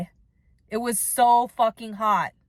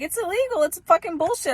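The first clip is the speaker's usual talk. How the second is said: mock outraged retort; 10 semitones higher than usual. Acted quarrel with border patrol.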